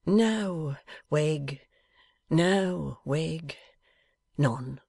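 mournful, resigned denial; slow and sad. A narrator's reading. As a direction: give in sadly, word by word. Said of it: "was the slow and sad reply".

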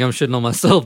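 usual speaking voice

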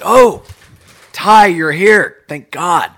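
high, loud, overjoyed bro voice; excited greeting. Retold or imitated. Guys under the bridge greeting him, loud and overjoyed. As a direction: hype him up like a long-lost friend.

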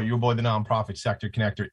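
ordinary delivery

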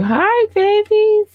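sweet high coo to her fans; cooing greeting. Acted. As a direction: coo it like greeting little ones.

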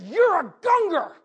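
high, loud, slow pronouncement; emphatic declaration. Retold or imitated. Mother telling her son who he is, emphatic declaring. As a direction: proclaim it with total conviction. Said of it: "something in her tone".

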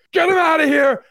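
high, loud hammed-up yell; mock crowd yelling someone out; mock screaming fans. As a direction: scream to throw him out. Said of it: "it would be screaming".

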